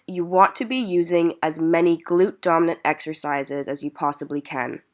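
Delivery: usual speaking voice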